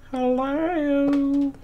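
high, drawn-out hello; sing-song calling out. Pretend. Player calling into an unknown place, sing-song hello. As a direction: hold it on one high, soft note. Calling out while searching game location.

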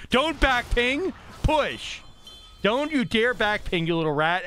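high, drawn-out warning to teammates; exasperated, slow, high-pitched telling-off; scolding command. Real, not acted. Scolds teammates, urging push.

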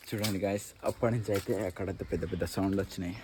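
low, soft ghost-hunt voice for the camera; hushed narration. Acted. Vlogger showing the viewers the haunted college in a hushed voice. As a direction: keep it low and secretive, as if something might hear.

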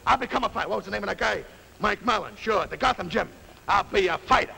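put-on mildly indignant street-kid bluster; jilted young fighter. Jilted kid vows to be a fighter, defiant. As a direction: vow it with wounded determination.

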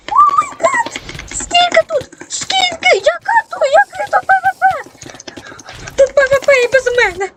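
high-pitched disbelief, fast; shocked outburst. Real, not acted. Gamer shocked that the battle started without him, squealing high.

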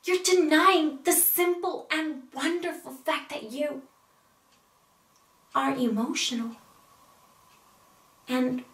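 earnest, wounded pleading; acted emotional appeal, pitch rising on the challenges; Amy monologue. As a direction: plead with a lover, pausing between thoughts. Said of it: "I will be portraying Amy".

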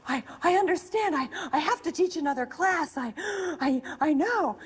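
high, urgent, stumbling insistence; imitated breathless, pleading intensity; intense. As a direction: act out feverish urgency, restarting words. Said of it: "started this kind of intense".

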